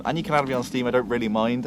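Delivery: ordinary talk, nothing marked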